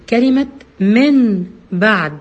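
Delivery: من بعد slow, nun turned to a nasal hidden meem before the ba; iqlab with ghunna. Shown as a demonstration. Teacher demonstrating iqlab in من بعد, slow and nasal. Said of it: "خليني آخد مثال".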